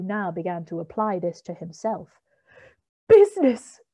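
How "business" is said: very high, loud wail; anguished cry. A narrator's reading. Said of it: "cried the ghost".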